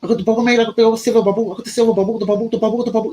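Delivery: high-pitched Manchester dealer gabble; put-on Manchester patter, pitched right up; mock Manchester accent. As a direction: rattle it off as a caricature Mancunian dealer. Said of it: "he's proper Man-, Manchester voice".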